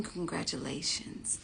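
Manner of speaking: slow, breathy whispered congratulations. Acted. Congratulating those who secured a spot, in a slow whisper. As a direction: hush it like a secret.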